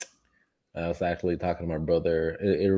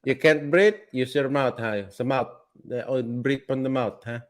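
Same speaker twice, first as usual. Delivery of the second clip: put-on higher-pitched, thick Filipino accent; comic Filipino doctor, huh after each instruction; Filipino-accented Fauci; 4 semitones higher than usual. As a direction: quiz the patient in a mock Filipino accent. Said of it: "Filipinos have to have that accent".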